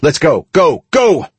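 high, rising rallying cry; urgent shout. A narrator's reading. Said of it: "I shouted".

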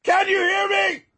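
high-pitched yell; shout. Shown as a demonstration. Dynamics demo, very loud contrast.

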